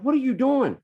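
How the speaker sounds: high, loud exasperated yell; retold screaming. Dad who lost it at his daughter, screaming. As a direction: snap loudly at a child, voice shooting way up. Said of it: "I started screaming at her".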